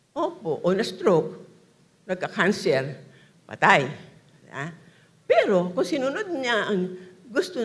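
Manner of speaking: loud preaching, each phrase pushed out hard; full-force preaching. Acted. Preacher challenges the congregation, loud and forceful. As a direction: drive the question home to the crowd.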